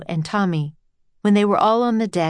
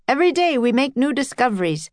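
eager scientist, higher and quick; brisk, raised-pitch character voice; Henry; 7 semitones higher, faster than usual. A narrator's reading. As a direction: rattle it off with a scientist's pride.

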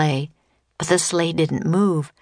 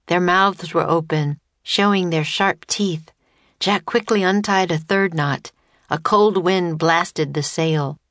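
ordinary delivery, nothing marked

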